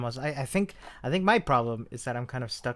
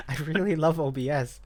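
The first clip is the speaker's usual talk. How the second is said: ordinary talk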